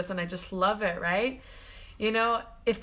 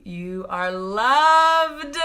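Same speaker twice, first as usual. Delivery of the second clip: very slow, drawn-out and louder; tender blessing; 9 dB louder, much slower than usual. Real, not acted. Blessing her viewers at the close of the live, tender. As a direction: stretch each word like a blessing.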